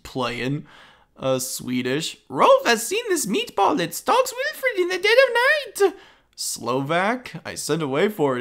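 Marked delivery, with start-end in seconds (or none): 2.3-5.9 silly high Rolf voice. Pretend. Voicing Rolf from the show.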